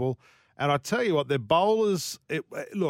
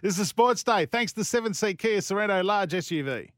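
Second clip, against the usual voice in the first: radio announcer; 6 semitones higher than usual. Acted. Host reads the sponsor line.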